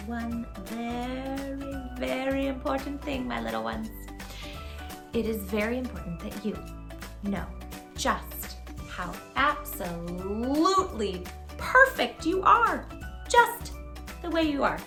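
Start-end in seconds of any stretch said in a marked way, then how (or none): none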